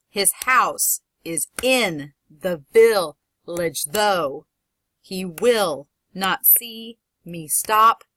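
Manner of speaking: slow iambic pairs, punching "house", "in", "though", "will", "see"; stress on second syllable. Shown as a demonstration. Teacher shows class the iambic punch, slow pairs stressing "house", "in", "though". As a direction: chop it into pairs, weight landing on "house", "in", "though", "watch", "woods". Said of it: "the word that has the punch is the second word".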